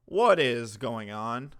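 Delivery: ordinary delivery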